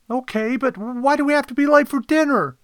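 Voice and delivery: high, loud whining complaint; assistant Kodet complaining. Acted. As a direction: grumble to the boss in a high voice. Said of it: "Kodet complained".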